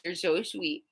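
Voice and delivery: ordinary talk, nothing marked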